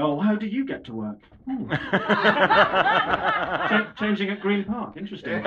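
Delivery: polite, dutiful small talk; British small talk. Retold or imitated. Making small talk at a party, mildly polite. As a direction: feign interest in a stranger's commute. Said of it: "British regulation small talk".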